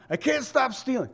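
high mock whine; whiny excuse voice. Mimics excuse-maker.

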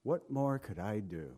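low, soft, heartfelt question; God's earnest, subdued appeal; wholehearted, sincere plea. Shown as a demonstration. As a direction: ask it quietly from the heart. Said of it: "he'd say it with all of his heart".

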